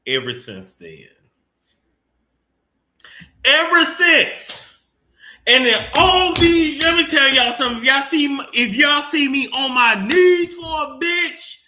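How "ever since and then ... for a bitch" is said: loud, high rant; high, loud outburst telling viewers off; worked-up shout. Real, not acted. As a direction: shout it out, fired up. Ranting, worked up at Malika.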